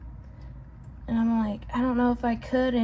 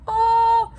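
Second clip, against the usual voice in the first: high, louder startled gasp of a word; sudden alarm; 11 semitones higher, 6 dB louder than usual. Real, not acted. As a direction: jolt as the fear hits you. Suddenly remembers the noises.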